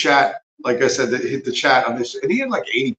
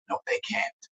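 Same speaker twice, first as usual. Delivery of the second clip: a sharp unvoiced whisper, much softer; 11 dB quieter than usual. Real, not acted. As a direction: hiss the denial under your breath. Flat rebuttal after the mock quote.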